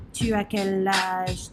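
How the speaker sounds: ordinary delivery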